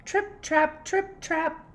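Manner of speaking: high, bouncy hoof-beat chant; trip trap. Acted. Teacher reading hooves crossing the bridge, high and bouncy. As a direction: tap it out like little hooves.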